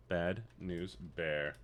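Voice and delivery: very soft, slow, low announcer tease; hushed movie-trailer intro; dramatic hush. Acted. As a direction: breathe it out like a trailer voice.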